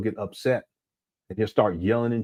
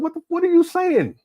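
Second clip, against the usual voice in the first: mock bafflement; 3 dB louder than usual. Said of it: "I said".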